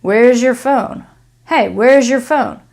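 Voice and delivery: "Where's your" run together as "wherezher"; connected Z plus Y. Shown as a demonstration. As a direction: blend "Where's your" with a zh in between. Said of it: "this Y turns into a zh".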